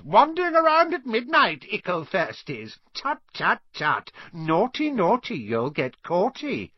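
high taunting sing-song; mischievous poltergeist. A narrator's reading. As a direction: tease them like a naughty imp. Peeves taunting.

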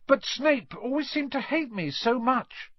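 a lightly voiced boy, earnest; Harry pleading to keep him talking; young Harry. Harry stalling Quirrell.

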